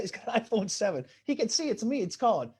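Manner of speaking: ordinary talk, nothing marked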